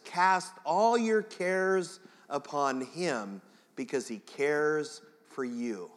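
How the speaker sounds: slow, measured recital of the verse; the well-known wording, said slowly; familiar version, slow. Shown as a demonstration. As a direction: read it slowly like a memorized verse. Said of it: "You know it in your brain like this".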